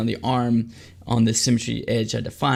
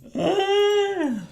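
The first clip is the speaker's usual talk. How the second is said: sudden high-pitched burst of laughter; 4 dB louder than usual. Real, not acted. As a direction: crack up, squealing.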